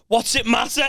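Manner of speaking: high, snappy retort; retold testy comeback; angry straight away. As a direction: imitate a dad instantly irritated. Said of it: "it's just angry straight away".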